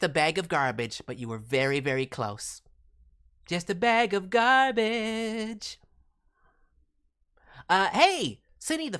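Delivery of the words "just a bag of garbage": slow silly voice. Pretend. Slow put-on garbage declaration.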